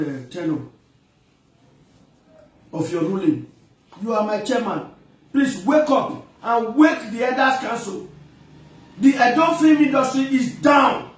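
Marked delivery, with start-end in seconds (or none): 5.3-11.1 raised, higher voice building to a shout; loud rallying plea; urgent appeal. Real, not acted. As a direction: sound the alarm to the elders. Pleading alarm to the elders.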